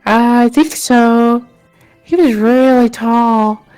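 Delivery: a hint of a dreamy local; villager voice. Same villager recalling childhood.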